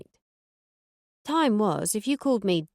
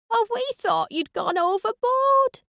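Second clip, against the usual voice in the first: much higher, loud Yorkshire shriek; shrieking Gloria; 5 dB louder than usual. Acted. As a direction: shriek it like an excited Yorkshire lady. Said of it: "shrieked Gloria".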